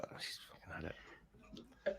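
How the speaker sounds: slow whispered aside to himself. Retold or imitated. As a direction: hush it under the breath, slowly. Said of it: "And I was like".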